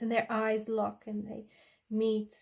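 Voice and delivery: normal talking voice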